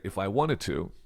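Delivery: ordinary delivery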